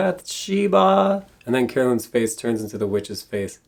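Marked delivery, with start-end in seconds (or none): 0.1-1.3 dramatic call. Retold or imitated. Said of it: "Ed says".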